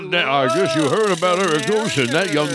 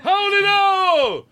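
sailor shout; much slower than usual. Pretend. Playing sailor calling out.

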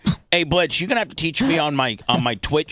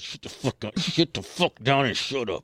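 through gritted teeth; 5 dB quieter than usual. Acted. Boyfriend to difficult girlfriend, quiet through gritted teeth. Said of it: "gritted his teeth and said".

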